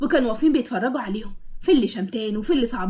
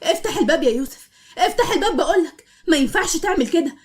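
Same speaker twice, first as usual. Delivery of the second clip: raised, rising angry demand at the door; wife shouting through the locked door to her husband, angry and rising; angry pounding plea; 6 semitones higher than usual. Acted. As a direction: bang on the door and demand to be let in, voice climbing. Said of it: "بكل عصبيه وكانت عماله ترزع".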